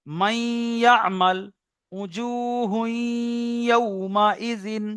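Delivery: মাইয়ামাল, উজুহুন ইয়াউমাইজিন with a long nasal hum on the merge; slow, sustained level-toned recitation; ghunna demo. Shown as a demonstration. Teacher showing merging with ghunna, drawn-out nasal recitation. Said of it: "গুণনাও করতে হবে।".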